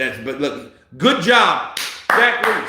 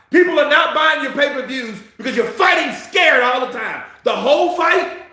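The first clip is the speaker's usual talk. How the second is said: yelling at the fighter; 7 semitones higher than usual. Real, not acted. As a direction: bark it at the TV like a fan. Ranting at the fighter.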